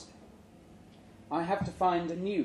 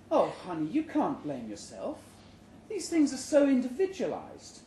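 consoling wife; 7 semitones higher than usual. Acted. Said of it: "She reaches across ... pats my hand".

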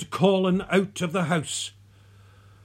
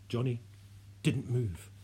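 ordinary delivery, nothing marked

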